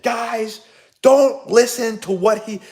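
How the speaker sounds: high, slow mock yell to the team; retold yell. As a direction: re-enact hollering slowly across the field. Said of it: "And I just yelled".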